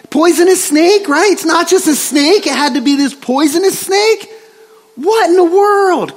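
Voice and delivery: high, exasperated disbelief; incredulous complaint. Acted. Preacher as Paul complaining about the poisonous snake, incredulous.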